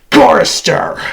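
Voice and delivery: sudden loud summons; commanding shout. Acted. Machine singles out a captive, menacing shout. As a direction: fire the name out hard. Calls out a victim.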